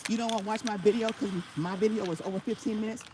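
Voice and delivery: ordinary talk, nothing marked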